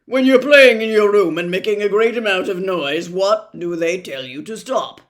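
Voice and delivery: loud, declamatory doctor voice; bombastic doctor. Acted. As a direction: fire out the question at full volume.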